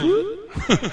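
very high squeaky whoop with a laugh; mock whoop. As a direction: yelp it like a startled driver. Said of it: "the guy over here goes".

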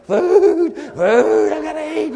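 high, loud comic wail; ravenous cry. Retold or imitated. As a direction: wail it like someone crazed with hunger, hammed-up. Acts out ravenous lurch toward food.

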